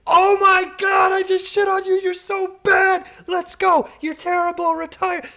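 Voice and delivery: over-the-top mock shout at the beaten opponent; imitating hype trash talk. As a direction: yell it like a pro gamer after a kill. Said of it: "His trash talk. He goes".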